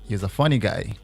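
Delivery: ordinary delivery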